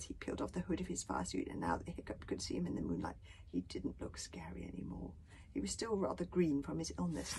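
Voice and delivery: ordinary delivery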